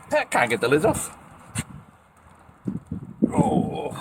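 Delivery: straining high on a stuck lid; strained voice while twisting hard; opening a stuck jar. Real, not acted. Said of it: "Where's me grips".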